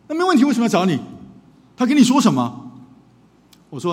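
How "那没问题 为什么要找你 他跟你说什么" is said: loud, high demanding challenge; father barging in, confronting the counselor; angry father. Retold or imitated. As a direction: demand answers, no courtesy at all. Father pressing counselor angrily.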